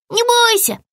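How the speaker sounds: высокий ободряющий окрик; подбодри криком, заметно выше обычного; крик зайчика. A narrator's reading. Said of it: "крикнул он". (кричит высоким голосом зайчика)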